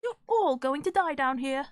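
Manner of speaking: put-on little British girl's menacing voice; Red Queen. Shown as a demonstration. Creepy little girl warning intruders, British child villain voice. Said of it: "Red Queen voice".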